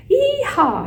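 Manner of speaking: high, whooping holler; cowboy yell. A narrator's reading. Hamster riding on frog's back, yelling. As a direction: whoop like a bronco rider. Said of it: "I yelled".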